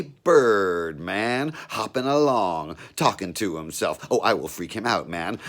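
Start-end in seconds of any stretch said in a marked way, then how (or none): none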